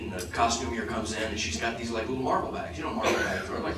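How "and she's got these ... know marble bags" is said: ordinary talk, nothing marked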